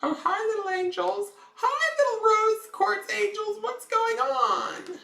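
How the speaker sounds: high cooing voice to crystals; baby talk. Acted. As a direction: coo at them like babies. Greeting crystals like babies.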